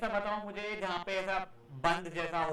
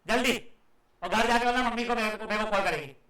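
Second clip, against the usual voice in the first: loud, urgent teacher's reprimand; angry scolding; 5 dB louder than usual. Real, not acted. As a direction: hurry the student out with raised voice. Teacher still scolding the student. (गुस्से भरी ऊँची आवाज़ में डाँट)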